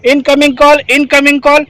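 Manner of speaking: loud, clear radio call; radio call demo. Shown as a demonstration. Trainer pressing the button and calling the team, clear radio call. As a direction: call it out firmly over the set. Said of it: "आप अब बोलने के लिए ready हो गए".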